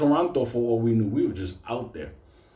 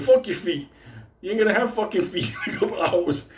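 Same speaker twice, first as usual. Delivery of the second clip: laughing retort; 5 semitones higher than usual. Retold or imitated. Snapping back at whining Benito.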